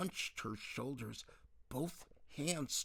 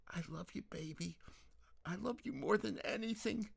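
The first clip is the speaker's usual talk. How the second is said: high, tender mother voice; 10 semitones higher than usual. Acted. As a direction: soothe her gently to sleep.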